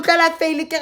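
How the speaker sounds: ordinary talk, nothing marked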